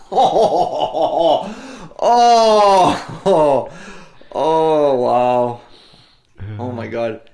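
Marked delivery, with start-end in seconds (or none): 0.0-5.6 loud laughing oh, oh, oh; shocked laughter. Real, not acted. Shocked at offensive joke.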